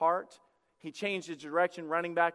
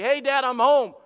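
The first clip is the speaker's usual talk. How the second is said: mock casual, louder and higher; breezy homecoming; 5 semitones higher, 8 dB louder than usual. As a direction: breeze in like nothing happened. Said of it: "and it's like".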